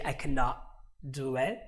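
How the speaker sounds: "cannot" stopped short, a pause, then "do it"; pause before DO. Shown as a demonstration. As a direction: leave a clear gap between "cannot" and "do". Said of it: "place a pause between the two words".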